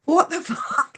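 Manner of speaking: very high, laughing squeal of disbelief; shocked outrage. Retold or imitated. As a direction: replay the shock in a comic squeak. Retold shock at water in face.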